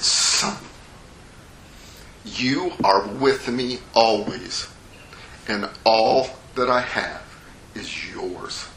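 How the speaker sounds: slow, measured reassurance, dropping to a hush; tender father. Acted. Father answers his indignant older son, tender. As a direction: reassure your son gently, letting each phrase settle.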